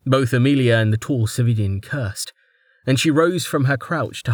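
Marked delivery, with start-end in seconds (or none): none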